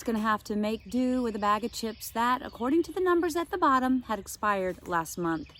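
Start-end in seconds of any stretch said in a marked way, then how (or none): none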